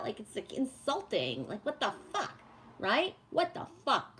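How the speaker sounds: ordinary talk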